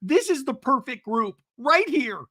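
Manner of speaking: high, slow, gushing declaration; mock team spirit. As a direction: overdo the heartfelt group pride. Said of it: "It just feels that vibe".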